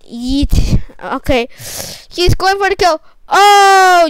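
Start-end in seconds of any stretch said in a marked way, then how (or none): 3.3-4.1 sudden loud high exclamation; a startled shout of oh; surprised oh. Real, not acted. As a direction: caught off guard mid-fight. Sudden kill in the game.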